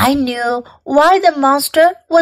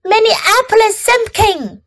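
high-pitched scolding call; mom yelling; 8 semitones higher than usual. A narrator's reading. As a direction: call the child's full name in a mild, high yell. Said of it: "yelled Mom".

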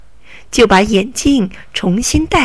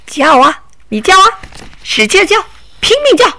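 mocking taunt; 8 semitones higher, faster than usual. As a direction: egg her on scornfully. Robber taunts her to shout.

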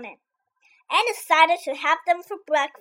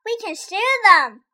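friendly; 6 semitones higher, 6 dB louder than usual. A narrator's reading. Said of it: "suggested the friendly ladybug".

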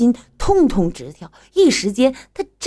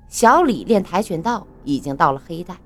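usual speaking voice

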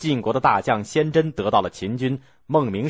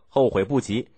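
ordinary delivery

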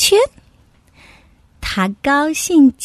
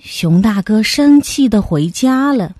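ordinary delivery, nothing marked

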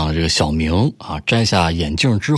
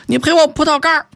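put-on high, loud yell of a young man; roaring demand; 4 dB louder than usual. Young man yells at the driver, loud high roar. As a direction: bellow it back at him. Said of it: "冲我大吼".

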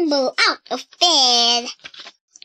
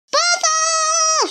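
much higher, carrying shout of a name; mother shouting. A narrator's reading. Mother yelling to wake Bella, high shout. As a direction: yell up the stairs for her daughter. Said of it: "she shouted".

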